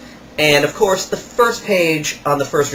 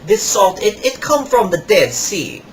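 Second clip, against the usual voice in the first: higher, slow put-on salesman voice; imitating the salesman; 4 semitones higher, slower than usual. Kiosk salesman rubbing salt on a customer's hands, imitated higher and slower. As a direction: ham up the pushy kiosk seller. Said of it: "So he's like".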